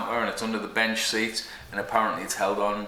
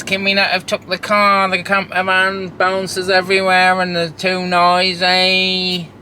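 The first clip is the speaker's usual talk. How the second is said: put-on slow, higher whining child voice; whiny kids; 7 semitones higher, 8 dB louder, slower than usual. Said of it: "All I get the whole way is".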